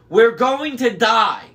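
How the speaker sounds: high, loud, drawn-out alarm; alarmed cry. A narrator's reading. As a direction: cry out in panic, stretching the words. Panicked warning in speeding taxi.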